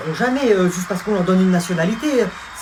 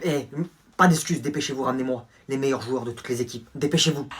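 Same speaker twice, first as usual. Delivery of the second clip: ordinary talk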